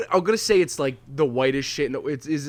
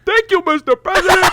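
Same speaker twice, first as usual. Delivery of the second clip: high, loud cartoon squeal with a laugh; goofy cartoon kid, laughing through it; Ed voice impression; 7 dB louder than usual. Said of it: "in that accent".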